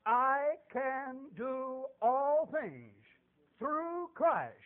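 loud, drawn-out verse, word by word; proclaimed scripture. Real, not acted. Preacher gives congregation his life verse, slow and ringing. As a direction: declare the verse slowly and weightily.

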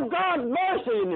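high, drawn-out cry for mercy. Retold or imitated. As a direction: plead loudly to God, stretching the words. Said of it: "he cried out".

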